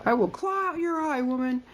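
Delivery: high, hammed-up catfight snarl; catty threat. One woman threatening another over a man, mock snarl. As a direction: spit it out like a jealous rival.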